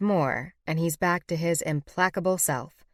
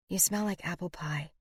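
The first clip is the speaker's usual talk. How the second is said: much softer, hushed; whispered; 8 dB quieter than usual. A narrator's reading. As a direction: breathe it close and dazed. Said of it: "I whisper inanely".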